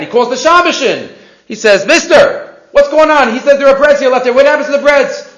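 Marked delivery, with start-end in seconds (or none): 1.8-5.3 high, loud acted demand; imitated indignant questioning; confronting. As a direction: call him to account. Rabbi confronts the caretaker.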